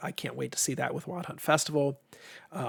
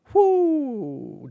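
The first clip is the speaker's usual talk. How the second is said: high, loud, drawn-out whoo; exasperated whoo; 11 semitones higher, 7 dB louder than usual. Real, not acted. Streamer griping about allergy season, exasperated whoo. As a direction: let out a long whoo that swoops down.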